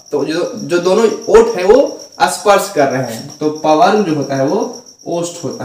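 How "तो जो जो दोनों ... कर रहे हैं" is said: usual speaking voice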